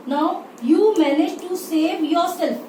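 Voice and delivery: ordinary talk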